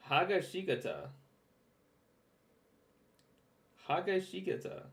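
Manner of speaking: Hagashikata with a flat English "Ha" and loose vowels, repeated in disbelief; mocking mispronunciation. Viewer echoing narrator's botched name: mocking mispronunciation. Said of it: "This dude just said Hagashikata".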